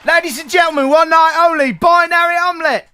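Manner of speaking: high, loud showman intro; concert announcer. Pretend. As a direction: hype up the band's entrance. Announces the band on stage.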